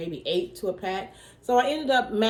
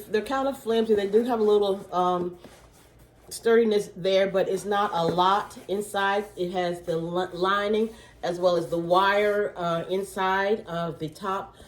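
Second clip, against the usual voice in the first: ordinary delivery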